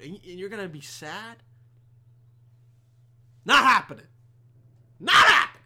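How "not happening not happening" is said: sudden loud, forceful refusal; emphatic shout. Real, not acted. Comic refusing to let anyone be sad, emphatic shout. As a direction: shout it down, louder and higher the second time.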